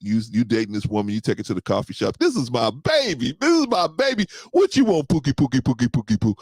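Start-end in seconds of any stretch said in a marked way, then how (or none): 2.2-6.3 put-on high cooing baby talk to a girlfriend. Boyfriend cooing to his new girlfriend, high baby talk. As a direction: coo at her like a smitten boyfriend.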